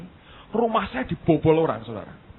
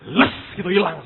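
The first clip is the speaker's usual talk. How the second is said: ordinary delivery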